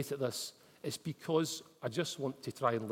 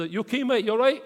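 raised voice through the car window; calling out; 7 semitones higher, 8 dB louder than usual. Retold or imitated. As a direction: call out to the stranger outside.

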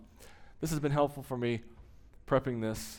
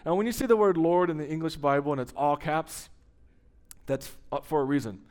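normal talking voice

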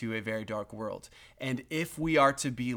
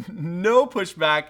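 usual speaking voice

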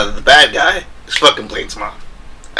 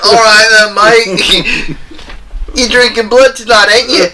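loud, high hammy English bloke; a pub-drunk English voice; English accent; 10 semitones higher, 3 dB louder than usual. Pretend. British Blade bit continues.

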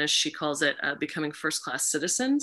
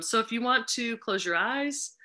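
soft, gentle guiding voice; guided grounding; 5 semitones higher, 4 dB quieter than usual. Acted. Facilitator guiding the group into calm, softly. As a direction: ease them into their bodies.

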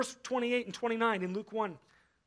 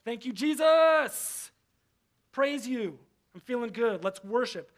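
mock cheery worship; 3 semitones higher, 6 dB louder than usual. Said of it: "show up at church and go".

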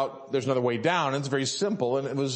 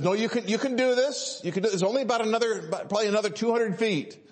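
higher, quick voice of an encouraging wife; 7 semitones higher, faster than usual. Retold or imitated. Wife calls up to climbing husband, higher encouraging voice. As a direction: imitate a wife urging him on, pitched up and fast. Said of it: "My wife looking up".

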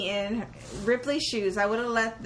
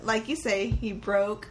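ordinary talk

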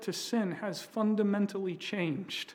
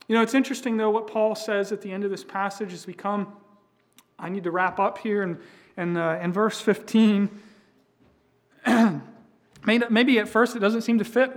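louder, higher pulpit voice; full-force preaching; 4 semitones higher, 8 dB louder than usual. Acted. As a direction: rouse the congregation.

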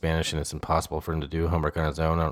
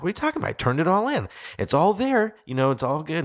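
much higher, incredulous mock protest; confused protest; 8 semitones higher than usual. Dad to wife over missing homework, high incredulous protest. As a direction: squeak out your disbelief. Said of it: "I'm like".

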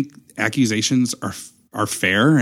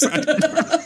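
giggling high through the words; both hosts laughing at the story; slower than usual. Real, not acted. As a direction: crack up mid-joke. Both cracking up.

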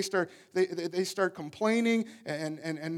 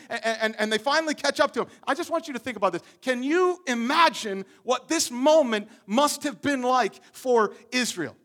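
ordinary talk, nothing marked

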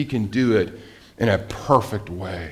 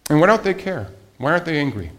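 ordinary delivery

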